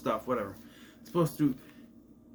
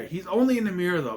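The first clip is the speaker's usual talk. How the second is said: ordinary delivery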